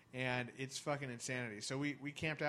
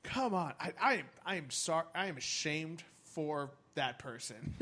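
mock indignation; 3 semitones higher, 4 dB louder than usual. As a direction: pretend to be scandalised. Mock scolding the smoker.

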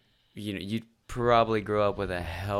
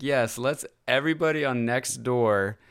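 higher, slow mocking sarcasm; sarcastic; 3 semitones higher, 4 dB louder, slower than usual. Mocking the woman who wants snake posts stopped, sarcastic. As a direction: drip sarcasm, slowing at the end. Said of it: "And it's like".

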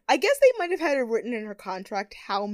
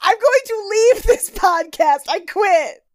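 mock indignant; 9 semitones higher, 7 dB louder than usual. Mock outrage at topic.

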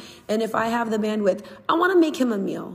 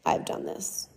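whispered aside; 7 dB quieter, slower than usual. Real, not acted. Sheepish aside about own car.

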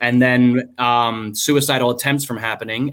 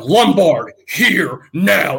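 higher, louder clipped orders; stern command; 5 semitones higher, 4 dB louder, much slower than usual. Retold or imitated. Sergeant ordering the soldier over, loud stern command. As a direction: call him over like a drill order.

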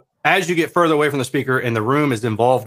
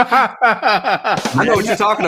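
talking through laughter, slowly; laughing; 8 semitones higher, much slower than usual. Real, not acted. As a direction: laugh while you answer. Laughs at the joke.

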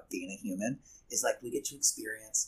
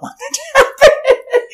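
laughing; 18 dB louder, slower than usual. Retold or imitated. Quoting herself, breaks into laughter.

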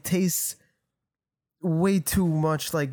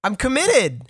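loud, high, fed-up protest; exasperated yelling; 11 semitones higher, 4 dB louder than usual. Real, not acted. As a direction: yell it, fed up with them. Heated argument about cutting.

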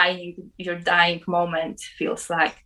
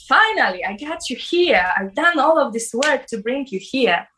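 voiced higher, a bright joyful girl; joyful soul voice; 5 semitones higher than usual. The soul greets her at last, higher and joyful. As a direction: sing out her welcome like a delighted traveler. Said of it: "like a traveler".

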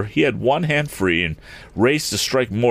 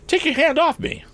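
indignant cry. A narrator's reading. Tom is grabbed by Morse, high indignant cry. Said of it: "cried Tom".

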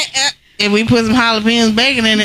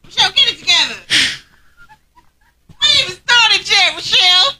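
scolding herself; 12 semitones higher than usual. Real, not acted. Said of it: "Sorry, I was thinking about balls".

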